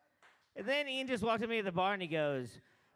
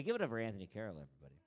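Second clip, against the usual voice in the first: fast, lower, softer, fading out; deflated repeat intro; 10 semitones lower, 5 dB quieter, much faster than usual. Real, not acted. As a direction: mumble it like you've given up. Repeats intro after nobody claps.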